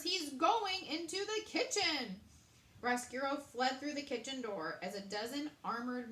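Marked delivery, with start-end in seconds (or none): 0.0-2.2 louder, higher shout; shouting chef. A narrator's reading. Chef shouting to the guards as the rat flees. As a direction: call out the chase. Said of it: "Andre shouted".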